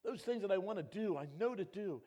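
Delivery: loud, high anguished cry; Paul crying out over his sin. Retold or imitated. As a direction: wail out the struggle. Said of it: "this great man of God says".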